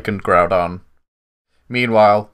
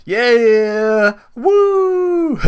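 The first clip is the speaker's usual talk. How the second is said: cheering; 12 semitones higher than usual. Real, not acted. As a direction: whoop with relief. Celebrating Nefashu's innocence.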